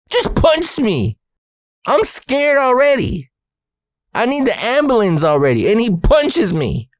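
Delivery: very high, stretched-out protest; comic outrage. Retold or imitated. Scared kid protests being punched, high comic outrage. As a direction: wail it in indignant disbelief.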